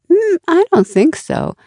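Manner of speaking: high-pitched, musing disagreement; growth mindset voice. Acted. Growth voice talking back to the critic, lightly dissenting. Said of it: "the growth mindset voice might say".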